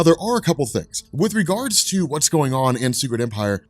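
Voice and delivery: normal talking voice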